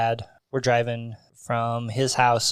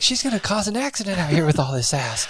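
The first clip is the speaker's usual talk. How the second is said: flustered dad; 6 semitones higher than usual. Retold or imitated. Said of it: "He's like".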